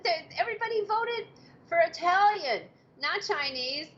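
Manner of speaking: retold high-pitched objection over the dinner vote; high protest. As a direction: squeak out an indignant objection. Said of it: "And I was like".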